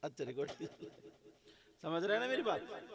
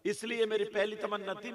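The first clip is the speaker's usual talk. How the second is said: ordinary delivery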